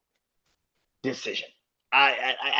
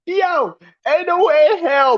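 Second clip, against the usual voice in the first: high, loud disbelief; incredulous outburst; 12 semitones higher, 5 dB louder than usual. Real, not acted. Ranting host, fed up with booking, yelps in disbelief. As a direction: yelp it like you can't believe it. Ranting about the stalled faction.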